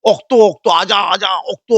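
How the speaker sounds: অক্ত and আজা both said without the r; mock pronunciation dropping r in অক্ত and আজা; mocking dropped r. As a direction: chant অক্ত, আজা, swallowing every r. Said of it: "উচ্চারণ তো ভালো হচ্ছে কেন".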